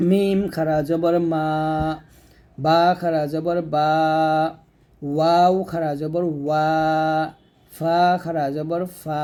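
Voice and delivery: slow letter drill, holding the last "মা", "বা", "ওয়া", "ফা" long; one-alif drawn-out vowel. Shown as a demonstration. Teacher shows students one alif of stretch, drawn-out "মা", "বা", "ওয়া", "ফা". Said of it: "তাহলে হবে এরকম".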